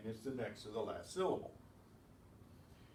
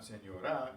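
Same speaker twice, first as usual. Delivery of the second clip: "señora" said as sen-yo-RA; wrongly end-stressed "señora", last syllable strongest. Shown as a demonstration. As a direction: land on the final RA of "señora". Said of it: "it's not señora".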